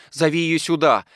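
roused, call for her at once; startled, eager. A narrator's reading. Daughter sends for her maid, startled and eager. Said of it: "встрепенулась Екатерина Егоровна".

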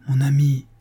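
mild imploring interruption; a touch of supplication; pleading. A narrator's reading. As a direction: plead gently with a friend. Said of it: "d'un ton suppliant".

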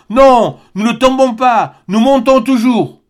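high, slow, emphatic triumph; excited exclamation. A narrator's reading. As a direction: proclaim it with rising excitement. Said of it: "s'écria Barbicane".

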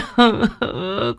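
high broken sob; a keening sob with no words; wailing sob. Acted. Mother sobs at the coffin, high broken wail. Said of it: "rú lên khóc to hơn".

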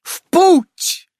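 very high, loud shriek; furious shriek. A narrator's reading. Amazon shrieking to set off, very high and loud. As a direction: shriek it in fury. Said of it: "яростно взвизгнула Амалия".

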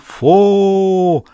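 high, drawn-out ritual call; long loud cry. Acted. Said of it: "громко и протяжно крикнул".

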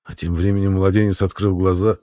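usual speaking voice

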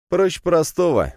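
high cocky smirk. Acted. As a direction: say it with a grin. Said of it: "ухмыльнулся я".

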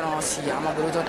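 low, soft resigned curse; dismayed mutter. Acted. Owner sees the piglet back at the door, dismayed mutter. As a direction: curse under your breath.

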